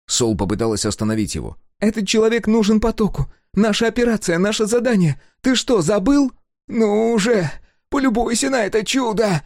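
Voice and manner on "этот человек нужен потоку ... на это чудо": high, louder, reproachful; scolding partner. A narrator's reading. Sol reproaching Sega about the mission, high and sharp. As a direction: remind him of the mission sharply.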